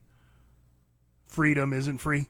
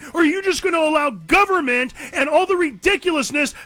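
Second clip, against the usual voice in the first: high, loud fiery preaching; rally the listeners, loud and slow; impassioned rant; 14 semitones higher, 7 dB louder than usual. Real, not acted.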